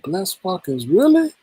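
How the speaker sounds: can't believe what you just heard; shocked disbelief. Retold or imitated. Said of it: "I was like".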